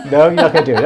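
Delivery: loud, laughing protest; amused and loud, laughing as you speak. Real, not acted. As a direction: say it through laughter, loudly. Teasing colleague about a face, laughing.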